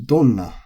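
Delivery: donna high on don, falling to na; accented. Shown as a demonstration. Teacher demonstrating accented question word, donna stressed on don. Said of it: "is accented".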